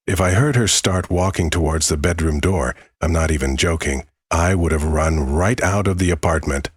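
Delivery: usual speaking voice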